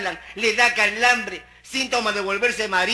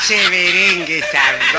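louder, laughing catchphrase shout; 6 dB louder, slower than usual. Pretend. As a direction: throw the slogan out loud with a laugh. Repeating the catchphrase.

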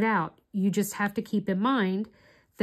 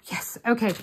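relieved gasp; 2 semitones higher than usual. Real, not acted. Relieved gasp at her work.

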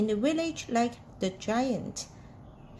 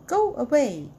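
narrated yell, a little louder; mild yell; 5 dB louder than usual. A narrator's reading. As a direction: lightly yell it as an angry crowd would in a story. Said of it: "yelled the people".

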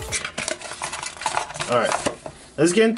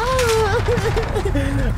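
high burst of laughter. Real, not acted. Amazed mid-sentence.

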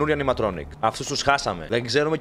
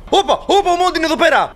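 high, loud startled cry; alarmed shout; 7 dB louder, faster than usual. Real, not acted. Player spots Monty nearby, shouting high and loud. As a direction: yell in alarm as Monty shows up.

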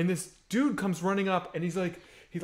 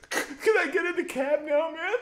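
battered plea; 9 semitones higher than usual. Retold or imitated. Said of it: "and he's like".

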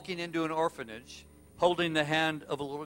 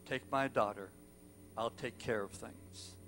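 low, soft, final words; quiet resignation; 5 semitones lower, 9 dB quieter than usual. Retold or imitated. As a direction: drop low and let it fade softer. Father's last words before suicide.

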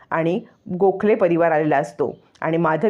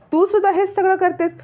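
angry accusing cry; 12 semitones higher than usual. Acted. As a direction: voice jumps much higher in outrage. Indra accusing daughter-in-law. (संतापाने चढलेला आवाज)

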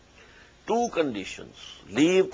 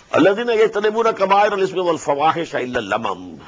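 melodic, measured tajweed chant; Quran recitation; 4 semitones higher, much faster than usual. Acted. Teacher recites the verse to his students, chanted tajweed. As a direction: intone the verse as in prayer.

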